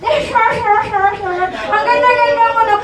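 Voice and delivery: put-on high, sugary baby talk to a sweetheart; mock baby talk. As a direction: coo at her like a smitten suitor. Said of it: "baby talk ka pa ng baby talk".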